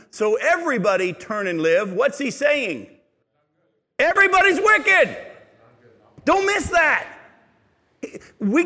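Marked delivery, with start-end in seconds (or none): 4.0-7.1 loud, high, hammering the point home; preacher to congregation, driving it home with a shout; emphatic shout. Real, not acted. Pastor urging the congregation not to miss it, loud and high.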